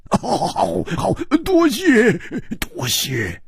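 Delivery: high, stretched gleeful thanks; gleeful villain. Acted. Killer thanks his partner for the kill, gleeful. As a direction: leap up high, then sink on the last thanks.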